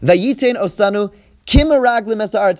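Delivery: ordinary talk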